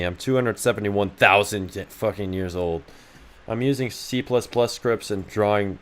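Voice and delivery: ordinary delivery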